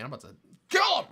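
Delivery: high, loud, fast comic blow-up; mock furious outburst. Keeper driven crazy by the cricket, mock-furious. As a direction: explode in pretend rage, as a joke.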